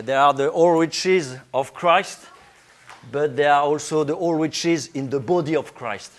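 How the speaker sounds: ordinary talk, nothing marked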